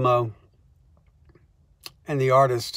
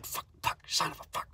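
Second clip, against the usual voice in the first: unvoiced whispered cursing; muttered swearing; 14 dB quieter, faster than usual. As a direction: curse under your breath, embarrassed. Embarrassed swearing under breath.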